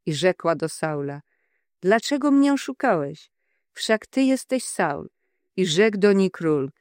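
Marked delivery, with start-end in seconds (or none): 1.8-5.1 crying out. A narrator's reading. The medium cries out to Saul in alarm, higher. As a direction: read as the medium's startled cry, pitched up. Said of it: "krzyknęła wielkim głosem".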